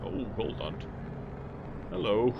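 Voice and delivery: ordinary delivery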